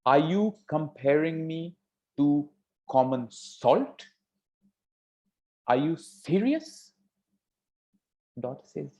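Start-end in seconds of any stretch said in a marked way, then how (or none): 0.0-6.9 high, slow, incredulous questions; flabbergasted. Acted. King questioning daughter about common salt, flabbergasted. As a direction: stretch each phrase upward in disbelief. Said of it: "The king got flabbergasted".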